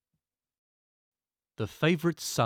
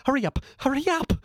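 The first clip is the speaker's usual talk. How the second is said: high, loud frantic cry; screaming; 12 semitones higher, 3 dB louder, faster than usual. Acted. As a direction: scream at the driver to hurry. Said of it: "screamed the philosopher".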